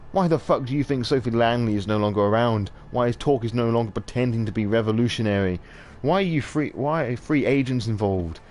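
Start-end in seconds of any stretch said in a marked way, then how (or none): none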